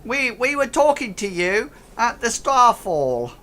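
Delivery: high, loud goblin voice; space goblin. Acted. Goblin reminding the bot where they met, high loud goblin voice. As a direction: pipe up loudly as a goblin. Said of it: "the space goblin".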